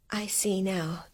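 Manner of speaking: barely voiced, horror sinking in; horrified whisper. Mary whispers as she understands the ghost. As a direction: let the words die in a whisper.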